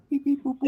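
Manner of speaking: high chirpy droid beeping; robot beeps. Pretend. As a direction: beep like a little Star Wars droid. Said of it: "we talk to him like a robot".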